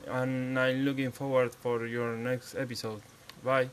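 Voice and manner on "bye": normal talking voice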